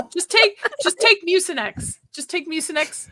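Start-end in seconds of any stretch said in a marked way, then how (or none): 0.1-2.0 said through a laugh, higher; amused suggestion cracking up mid-line. Real, not acted. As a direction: blurt it out while still giggling.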